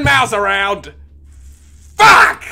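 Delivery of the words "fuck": loud, high angry yell; furious shout. Real, not acted. Gamer yelling at the game after another fall, furious shout. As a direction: explode at the screen.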